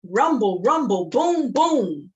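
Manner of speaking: loud, punchy rumbling chant; elephant rumble chant. Shown as a demonstration. Storyteller teaching kids the elephant sound, loud. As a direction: stomp it out like a big elephant. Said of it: "how about this".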